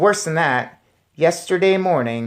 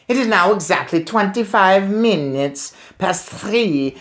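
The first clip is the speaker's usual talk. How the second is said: higher, excited witch; eager gloating witch; 6 semitones higher than usual. Acted. Grand High Witch speech, read aloud.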